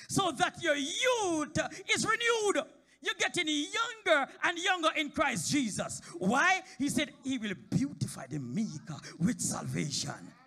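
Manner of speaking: high, loud, slow hollering; full-force preacher climax; preaching shout. Real, not acted. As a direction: shout it out to the congregation.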